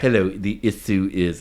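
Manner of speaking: mock upper-echelon British accent; upper-crust British. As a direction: put on a proper upper-class British voice. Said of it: "upper echelon British, like".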